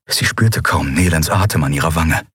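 plain reading voice

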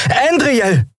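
high urgent call of the name. A narrator's reading. Lien warning Endriel of attacker, urgent call. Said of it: "rief Lien".

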